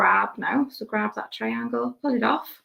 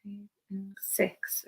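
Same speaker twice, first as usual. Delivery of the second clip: whispering; 12 dB quieter than usual. Real, not acted. Counting stitches under breath.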